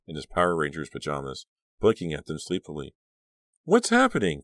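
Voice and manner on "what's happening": put-on high, loud child's voice; sleepy little brother. A narrator's reading. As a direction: ask like a small boy just woken. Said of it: "Cliff asked with a huge yawn".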